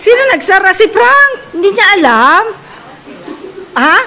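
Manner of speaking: high, loud shouting across the house; loud calling out. Real, not acted. Calling out to family in another room, loud. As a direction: call out loud to someone far off.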